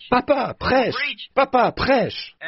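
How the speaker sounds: imite un petit-fils qui appelle, voix bien plus aiguë mais pas plus forte; cri d'enfant imité. Retold or imitated. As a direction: appel d'enfant mimé, aigu, sans vraie force. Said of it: "Il a dit". (petit-fils qui réclame le prêche, cri aigu imité)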